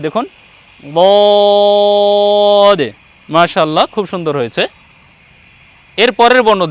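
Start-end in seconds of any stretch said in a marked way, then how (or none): 1.0-2.8 বদ as heavy Arabic daad, vowel held very long; tongue-side Arabic daad. Shown as a demonstration. Teacher demonstrates a difficult letter, long heavy বদ. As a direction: press tongue side to upper molars and stretch বদ. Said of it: "এটা বেশি বেশি উচ্চারণ করতে হবে".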